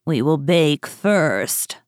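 slow, drawn-out witch; old woman. A narrator's reading. Witch planning to bake Gretel, slow and scheming. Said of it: "said the old woman".

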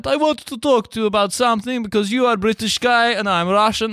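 high, solemn mock Russian accent; earnest Russian man confronting a Brit; high Russian accent, serious.

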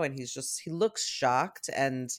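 normal talking voice

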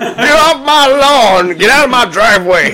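put-on high, very slow cranky old man; old prospector. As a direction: rant at the kids like an old geezer. Grumpy old man parody.

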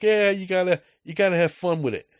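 Boston cashier teasing a Yankee fan; Boston accent. Retold or imitated. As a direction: egg him on in a Boston accent. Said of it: "Boston accent".

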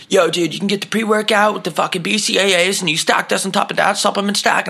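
high, pumped-up gym-bro pitch; mimicking a GNC worker pushing pre-workout; pushy gym bro. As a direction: hype it like a supplement salesman. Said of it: "the guy's like".

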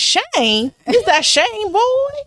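put-on high, drawn-out Southern mama voice calling out in a Southern accent. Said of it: "if there are any Southerners".

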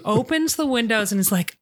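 ordinary talk, nothing marked

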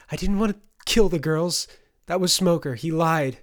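high, loud defensive outburst; distressed denial. A narrator's reading. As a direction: protest the blame urgently. Fay defends herself in distress.

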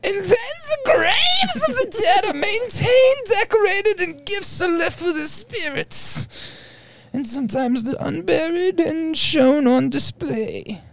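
very high, slow, wailing and quavering; high wailing ghost voice. Acted. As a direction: wail it like a ghost. Mock wailing while reading.

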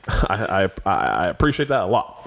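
lightly voiced Obama, low growl on a few words; Obama impression. Friends ask him to do Obama, so he speaks gravely. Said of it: "Do Obama now".

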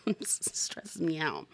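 slow, soft whispered aside. Real, not acted. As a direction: drop to a whisper, half laughing. Whispered aside after laughing.

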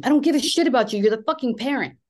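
high, loud retold yell of rage; furious outburst. Daughter yelling at her dismissive father in an argument, furious shout. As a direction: let it explode at him, voice cracking high. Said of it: "I lost my shit".

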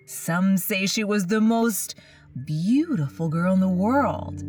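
ordinary delivery

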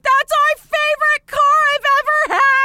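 loud, high mock wail; devastated mom. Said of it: "my mom was, like, devastated".

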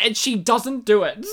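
usual speaking voice

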